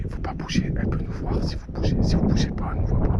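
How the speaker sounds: souffle, sans voix; chuchotement en se cachant. Real, not acted. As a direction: chuchote pour ne pas effrayer la loutre. Consignes chuchotées aux enfants. (chuchoté, caché près de l'eau)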